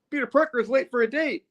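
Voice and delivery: high-pitched comic alarm; playful high-voiced fretting; mock panic. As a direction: squeal it as a flustered latecomer. Acts out Peter realizing he's late.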